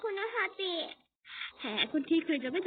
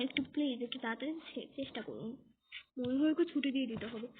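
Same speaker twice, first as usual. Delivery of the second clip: usual speaking voice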